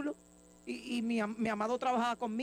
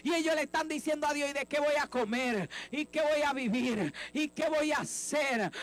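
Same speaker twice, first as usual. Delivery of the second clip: fiery preaching; 4 semitones higher than usual. Acted. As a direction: preach it out to the whole church at full force. Preacher rising to full force.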